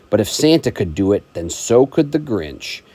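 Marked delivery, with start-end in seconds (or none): none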